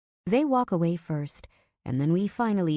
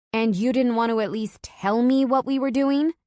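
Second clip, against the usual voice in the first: higher, louder indignant shout; wife demanding why she wasn't told; angry demand; 7 semitones higher, 6 dB louder than usual. Acted. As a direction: confront him, furious. Wife angrily confronting husband.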